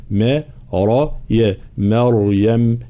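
ما light, را heavy, يا light, then مريم, slowly; syllable drill. Shown as a demonstration. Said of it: "ترقيق الميم وتفخيم الراء ثم ترقيق الياء".

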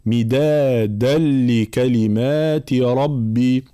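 slow chant, مدادا runs into لكلمات as a doubled l with no hum; merging without nasal. Shown as a demonstration. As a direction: recite مدادا لكلمات ربي slowly, tanween merged into the lam. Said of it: "إدغام بلا غنة مع بيان".

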